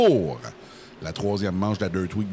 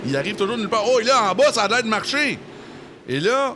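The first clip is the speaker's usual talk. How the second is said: excited call; 11 semitones higher, 5 dB louder, faster than usual. Real, not acted. Exciting pass in race.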